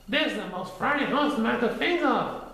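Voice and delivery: very high, fussy rabbit voice; annoyed Rabbit voice. A narrator's reading. Rabbit demanding why friends laugh, high and fussy. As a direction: squeak it out, annoyed.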